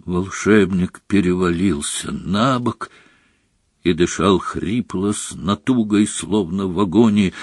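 ordinary delivery

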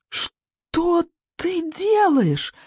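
high, slow, drawn-out wail; high frightened cry to his friend; terrified yell. Acted. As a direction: wail in horror. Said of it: "завопил рыбьенок".

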